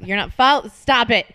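ordinary talk, nothing marked

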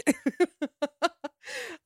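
high giggling laugh; tickled, high-pitched laughter; amused laugh. Real, not acted. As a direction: burst into giggles. Amused by the quoted insult.

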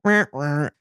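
imitated "wah, wah" letdown sound; sad trombone noise. Friend gets a dull stateside mission call, deflated trombone noise. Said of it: "it was like".

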